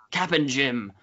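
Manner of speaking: usual speaking voice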